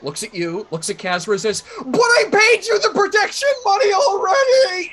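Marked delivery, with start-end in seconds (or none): 1.8-4.9 put-on high, full-volume panicked kid's scream; shrieked, aggrieved child's protest. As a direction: wail it at the top of your lungs. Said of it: "he screams it at the lo-, at the top of his lungs".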